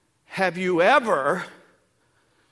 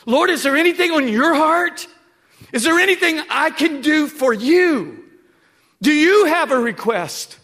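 higher, louder pleading to the Lord; earnest prayer; 8 semitones higher, 7 dB louder than usual. Pretend. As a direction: call out to God with full voice. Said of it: "stop for a minute and go".

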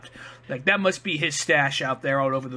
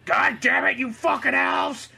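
high, drawn-out mock yell at the elves; put-on exasperated cursing, stretched out; imitating an angry Santa; 8 semitones higher, slower than usual. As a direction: scold underlings in a pitched-up mock fury. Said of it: "notice how his voice changes when he's talking to them".

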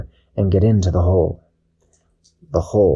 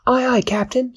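much higher chirpy reply; cheerful Dashi. Acted. Dashi answering the captain, high chirpy voice. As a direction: snap back a happy salute.

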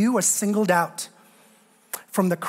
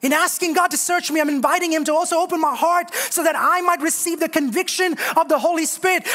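raised, fervent preaching prayer; 8 semitones higher than usual. Acted. Pastor leading his church in a fervent prayer. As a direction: pray it out to God from the pulpit. Preacher praying aloud at full force.

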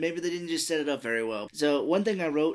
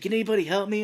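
raised, louder mock plea; higher, louder imitation of someone pleading; retold plea for help; 6 semitones higher, 6 dB louder, faster than usual. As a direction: call out for help, hammed up a little.

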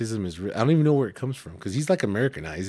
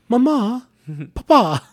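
high, loud mock Italian toddler accent; 12 semitones higher, 8 dB louder, slower than usual. Parent imitating the daughter calling her parents, Italian accent. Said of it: "she has, like, a fucking Italian accent".

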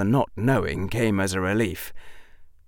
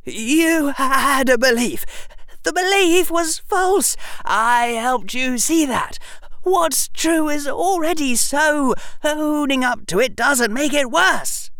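shaky voice; 5 dB louder than usual. Acted. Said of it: "Harry's voice was shaky".

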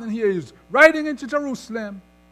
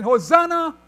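raised, higher preacher's cry; 6 semitones higher, 7 dB louder than usual. Retold or imitated. Preacher quoting the crowd behind Jesus, crying out. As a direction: call it out loud and high. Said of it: "were crying out".

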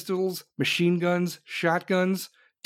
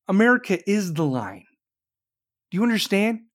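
usual speaking voice

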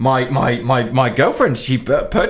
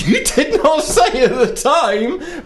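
high, slow, laughing indignation; indignant; much slower than usual. Retold or imitated. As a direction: spell it out with mock outrage. Indignant retort to clerk.

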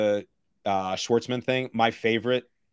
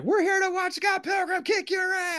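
much higher, put-on voice of the girl; mock girl voice. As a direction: squeak the threat out in an imitated girlish voice. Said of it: "she's just like".

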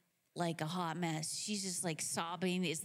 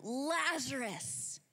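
long, drawn-out call, raised in pitch; Jesus; 4 semitones higher, much slower than usual. Acted. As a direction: call out slowly to someone in a tomb. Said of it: "he calls out".

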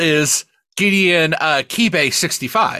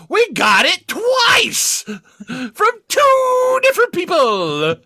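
mock shout; 12 semitones higher, much slower than usual. Mock outrage at spam email.

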